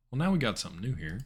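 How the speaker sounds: ordinary talk, nothing marked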